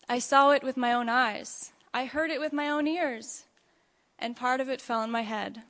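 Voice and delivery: ordinary delivery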